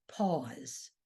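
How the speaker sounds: soft unvoiced whisper. Teacher whispering the stage direction to the class. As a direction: breathe the word as a stage aside. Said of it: "whispered stage direction".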